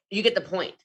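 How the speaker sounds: ordinary talk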